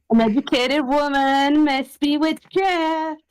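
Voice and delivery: high, drawn-out mock accusation; mock witch accuser. Co-host playing along with the witch joke, mock proclamation. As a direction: proclaim it slowly in mock horror.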